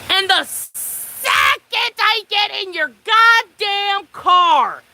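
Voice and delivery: drawn-out, high, emphatic complaint; slow, high-pitched exasperation; exasperated rant. Real, not acted. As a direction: stretch each word out, indignant and punchy. Ranting at date's predictable behaviour.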